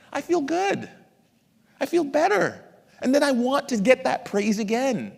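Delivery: high, gleeful acted delight. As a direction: pitch it way up, thrilled by praise.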